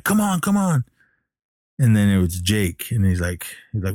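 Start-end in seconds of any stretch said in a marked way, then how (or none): 0.0-0.8 high-pitched urgent challenge, not shouted; mock challenge. Man with a bat to an intruder, mock challenge. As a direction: imitate someone jumpy daring an intruder.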